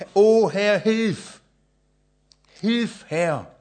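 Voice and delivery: high, loud desperate plea for help; crying out to God. Acted. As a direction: call out to the Lord in need. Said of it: "Ihn anrufen".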